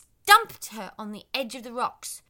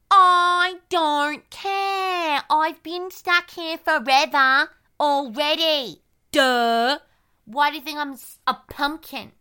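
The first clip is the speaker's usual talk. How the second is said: put-on whiny pumpkin boy; 3 semitones higher, 10 dB louder, slower than usual. Pumpkin boy sulking loudly.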